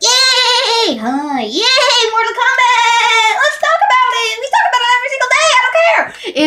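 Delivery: very high, loud, hammed-up shouting kid; mocking the annoying kid. Classmate shouting about his game every day, very high hammed-up yell. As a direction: yell it like a hyper, obsessed kid. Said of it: "This kid being like".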